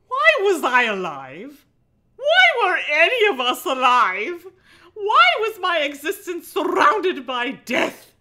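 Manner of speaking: dramatic story reading. A narrator's reading. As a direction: read it like a brooding teen novel.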